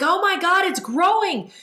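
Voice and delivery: high, loud retold panic; mock tearful alarm. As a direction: cry out in acted distress as it grows. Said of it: "I started crying as I'm saying this".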